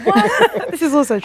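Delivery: high, loud mock outrage; playful indignation. Real, not acted. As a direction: act offended in fun.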